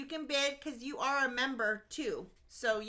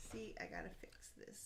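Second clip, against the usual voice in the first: whispering; 7 semitones lower, 16 dB quieter, faster than usual. Real, not acted. Aside while running the auction.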